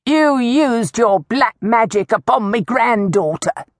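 put-on old woman's high, indignant accusation; accusing roar. A narrator's reading. Old woman blames Amicia for bewitching her granddaughter, high outraged accusation.

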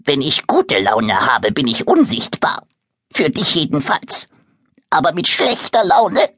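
leicht verstellte Stimme eines mürrischen kleinen Monsters; kleines Monster, leicht verstellt. Acted. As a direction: ein griesgrämiges Monster erklärt sich. Monster erklärt seine Laune. (das Monster erklärt Moritz mürrisch seine Unsichtbarkeit)

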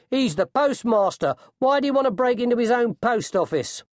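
high, indignant coachman's voice; exasperated coachman. A narrator's reading. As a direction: argue down a foolish colleague. Coachman argues with suspicious ostler.

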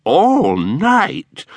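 high, startled cry; mother-in-law crying out in disbelief; shocked cry. A narrator's reading. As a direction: gasp the question out in disbelief. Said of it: "cried Missus Jinniwin".

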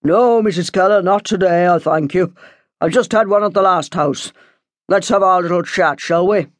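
lightly voiced Irish priest; a hint of a parish priest; visiting priest. A narrator's reading. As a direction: politely decline and get down to business. Priest's figure in narration.

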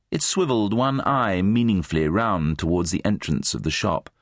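ordinary delivery, nothing marked

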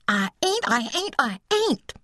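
put-on high, insistent childish denial; hysterical boy. A narrator's reading. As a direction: deny it like a worked-up little boy. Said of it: "Charlie exclaimed hysterically".

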